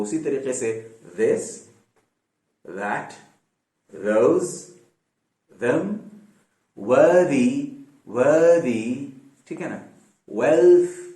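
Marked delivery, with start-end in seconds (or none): 1.2-8.9 this, that, worthy with a voiced th; voiced th words. Shown as a demonstration. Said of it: "زبان باہر نکالتے ہو". 10.4-11.1 wealth ending on a soft th; soft th demo. Shown as a demonstration. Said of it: "soft ٹی ایچ آتا ہے".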